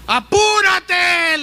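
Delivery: high, loud, drawn-out hurry up; impatient yell. Retold or imitated. Desperate man yells at his rescuer to hurry. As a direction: blurt it out in frustration. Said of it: "le dijo".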